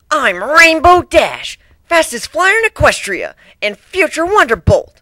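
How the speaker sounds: puffed-up cocky flyer showing off; boastful Rainbow Dash. Acted. Bragging to newcomers about being fastest, loud and high. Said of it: "she puffed herself up".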